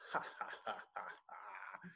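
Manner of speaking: breathy sly chuckle to himself; a scheming dragon's under-the-breath laugh. Acted. Dragon laughing at his trick, sly breathy chuckle. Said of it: "the dragon laughs to himself".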